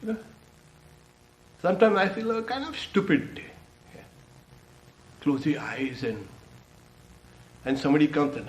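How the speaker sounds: normal talking voice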